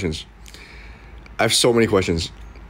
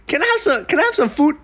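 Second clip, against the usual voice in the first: much higher, small plea for food; high pleading voice; 12 semitones higher than usual. Retold or imitated. Vlogger mimics asking kidnapper for food, high pleading. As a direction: beg timidly like a hungry captive.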